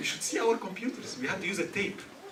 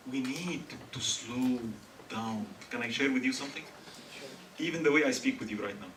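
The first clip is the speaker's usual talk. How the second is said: ordinary talk, nothing marked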